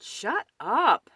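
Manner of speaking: loud, high angry snarl. A narrator's reading. Mouse fed up with the noise, loud snarl. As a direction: snarl it at everyone. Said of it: "Mouse snarled".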